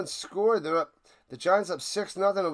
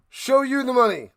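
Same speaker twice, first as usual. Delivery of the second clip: answering yell; 7 semitones higher, 6 dB louder than usual. Acted. Other role in the scene.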